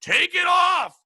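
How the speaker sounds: high, loud heckle; drawn-out crowd shout; retold yell. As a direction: holler it at the stage from the crowd. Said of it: "So I just yell out".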